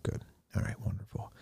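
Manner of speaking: very soft, whispered acknowledgement; stoic priest, hushed. Retold or imitated. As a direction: murmur it like an unruffled old priest. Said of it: "sort of stoic priest said".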